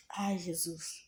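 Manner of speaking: barely voiced "Ai, Jesus"; soft prayer. Praying alone to Jesus in pain, hushed. Private intimate prayer to God.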